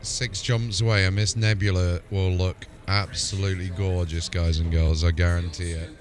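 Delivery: ordinary delivery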